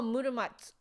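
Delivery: "Muramatsu" ending in a bare "ts", vowel dropped; clipped final tsu. Shown as a demonstration. Realizing chat's point, "Muramatsu" with a vowelless "tsu". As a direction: say "Muramatsu" with the last U swallowed. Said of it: "is often just pronounced tsu".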